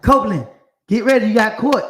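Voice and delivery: loud call down the cell block, a guard summoning an inmate. Retold or imitated. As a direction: shout the name across the jail. Guard calling him to court.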